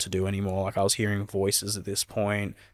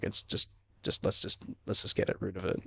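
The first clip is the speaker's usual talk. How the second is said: hushed plea; 7 dB quieter than usual. Retold or imitated. Begging lawyer to drop it.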